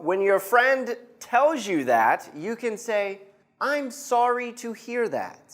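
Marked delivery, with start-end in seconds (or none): 3.7-5.4 higher, softer model line; teacher modelling a sympathetic reply. Shown as a demonstration. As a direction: say it gently for the class to repeat. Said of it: "I'll say it one more time".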